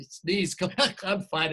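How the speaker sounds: plain reading voice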